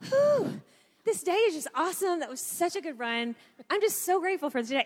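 high whoosh then bubbly, elated chatter; husband back from his run, gushing to his wife, high and elated; glowing husband. Retold or imitated. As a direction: breathe out big, then gush about the day.

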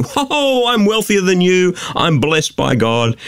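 exaggerated gloating whoop into a boast; mock boasting. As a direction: imitate smug rich people showing off. Mimicking boastful wealthy people.

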